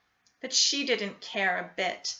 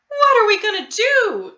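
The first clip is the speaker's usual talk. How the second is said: cried; 11 semitones higher, 13 dB louder than usual. A narrator's reading. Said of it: "cried Missus Cream".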